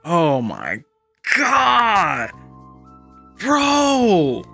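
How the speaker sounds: high, drawn-out cries of amazement; blown-away exclamation. Real, not acted. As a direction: wail it out high, overwhelmed by what you see.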